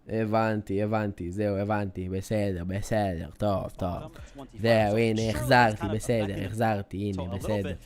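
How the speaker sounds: low, soft dejected mumble; mock sulk. Streamer sulking at chat leaving, mock dejection. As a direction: play hurt and deflated.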